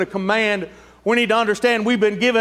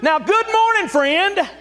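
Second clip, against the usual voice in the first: booming greeting; 7 semitones higher, slower than usual. Shown as a demonstration. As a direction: blast it like a revival shout. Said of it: "they might pass out if you did that".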